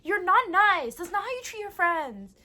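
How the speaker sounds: a little sister scolds her brother loudly; scolding little girl. Retold or imitated. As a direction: lecture him like a tiny teacher. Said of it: "she goes".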